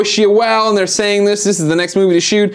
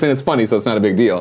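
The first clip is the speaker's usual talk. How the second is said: ordinary talk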